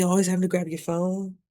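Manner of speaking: ordinary talk, nothing marked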